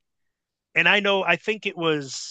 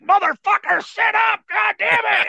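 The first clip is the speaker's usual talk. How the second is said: high, strained shout; retold angry yell; 5 dB louder than usual. Wrestler yelling at the rookie he can't lift, strained shout. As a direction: yell it like a struggling opponent.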